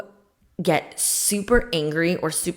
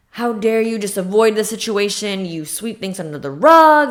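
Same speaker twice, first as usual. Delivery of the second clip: higher and louder, accusing the partner; acted angry outburst; 5 semitones higher, 6 dB louder than usual. Freaking out at a new boyfriend for taking time to regroup, acted angry outburst. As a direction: play the jealous partner snapping back in outrage.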